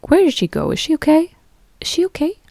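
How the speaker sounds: worried. Acted. Friends wondering where she went, acted worried. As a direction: act out anxious friends fretting, voice climbing. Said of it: "a little bit worried".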